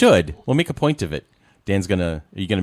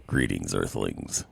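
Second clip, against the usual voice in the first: very low and slow; slow booming greeting from space; deep alien voice; 13 semitones lower, 10 dB quieter, much slower than usual. Pretend. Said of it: "this voice hurts after a moment or two".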